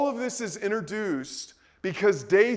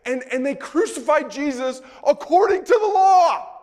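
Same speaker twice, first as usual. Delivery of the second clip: loud, higher indignant preaching; indignant shout; 6 semitones higher, 6 dB louder than usual. Real, not acted. Preacher to his congregation about the Pharisees, an outraged shout.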